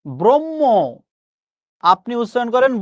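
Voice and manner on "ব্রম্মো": "ব্রহ্ম" pitched high as brom-mo; common mispronunciation. Shown as a demonstration. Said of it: "আসলে এটা ভুল".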